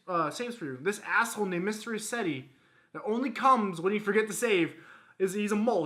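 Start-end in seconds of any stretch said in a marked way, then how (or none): none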